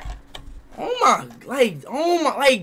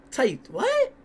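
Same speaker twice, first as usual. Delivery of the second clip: ordinary talk, nothing marked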